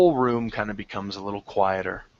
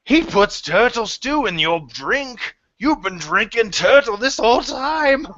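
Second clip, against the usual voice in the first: laughing patron; 10 semitones higher than usual. Acted. Tavern patron laughing out accusation.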